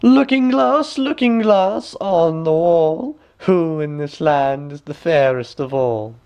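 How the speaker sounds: high, loud, slow queen's incantation; high slow regal chant. A narrator's reading. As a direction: call to the mirror like a vain queen. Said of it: "she asked of the looking-glass".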